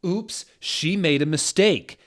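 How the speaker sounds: blaring. Acted. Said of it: "Dumbledore blared at her".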